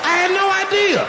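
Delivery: high squeaky disbelief; mock shock. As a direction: squeal it in comic panic. Shocked realization at club.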